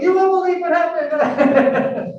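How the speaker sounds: high, slow, laughing through the words; a laughing retelling of the uncle's text; mock-amazed uncle, chuckling; laughing disbelief. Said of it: "texting me right away, like".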